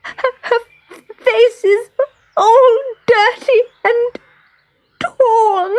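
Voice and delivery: put-on very high, stuttering child's sobs; sobbing. A narrator's reading. Little girl showing her torn, dirty doll, sobbing. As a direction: wail it through tears, halting. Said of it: "she sobbed".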